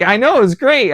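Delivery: gleeful. Retold or imitated. Gleeful reply to boss about overtime.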